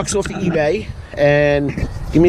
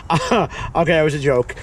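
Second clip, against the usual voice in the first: ordinary talk, nothing marked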